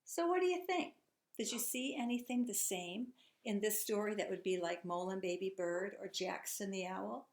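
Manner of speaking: ordinary talk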